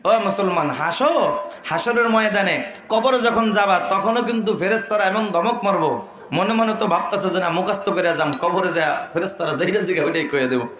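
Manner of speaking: ordinary talk, nothing marked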